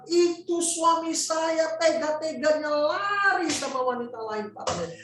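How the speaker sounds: acted tearful anguish. Betrayed wife tells pastor of husband leaving, acted anguished wail. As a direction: pour out heartbreak as the crying wife, pitched high. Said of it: "Dia nangis".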